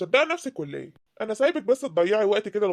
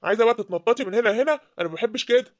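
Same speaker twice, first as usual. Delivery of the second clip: أب غاضب يأمر; 5 dB louder than usual. Acted. الأب يفرض الجواز بغضب.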